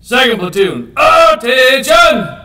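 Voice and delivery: high, slow, loud drill command; drawn out parade commands. Real, not acted. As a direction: bellow it across the parade ground.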